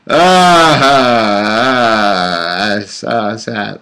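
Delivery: hammed-up pretend crying that dies away; fake sobbing. Crowd of courtiers faking sobs for the king, mock wails. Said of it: "that many people pretend crying".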